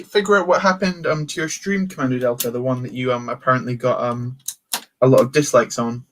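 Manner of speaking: usual speaking voice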